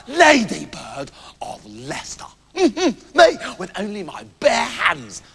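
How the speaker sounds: slow, pompous tall tale; swaggering one-upmanship; rival boastful hunter. Acted. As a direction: relish and stretch the heroic words. Plays second hunter.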